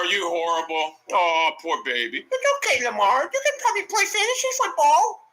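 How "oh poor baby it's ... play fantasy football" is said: sarcastic coddling, jumping high; mock pity. Real, not acted. Mock comforting Lamar after a bad play, sarcastic.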